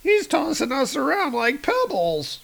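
cartoonish screaming circus folk; panicked fleeing. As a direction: squeal in hammed-up terror. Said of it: "they're like".

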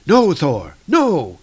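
mock desperate plea; crying out. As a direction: call out to stop Thor. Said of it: "Balder is kinda crying out".

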